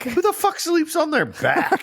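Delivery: high-pitched, laughing disbelief; comically baffled squawk; incredulous outburst. Retold or imitated. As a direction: soar up in mock outrage with a laugh. Incredulous reaction in retold exchange.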